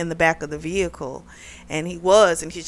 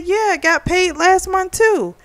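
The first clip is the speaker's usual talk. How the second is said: a pitched-up imitation of her; high mock voice; 11 semitones higher than usual. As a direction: quote her much higher. Retold argument, quoted in high voice.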